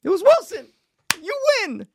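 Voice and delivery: high, loud answer reveal; excited. Real, not acted. Reading the quiz answer.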